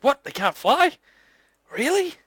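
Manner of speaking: surprised stranger. Acted. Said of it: "he goes".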